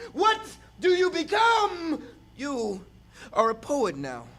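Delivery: intense spoken word performance, rising and falling; slam poetry. Acted. Poet performing on stage for the audience, impassioned spoken word. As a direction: preach the poem to the crowd like a sermon.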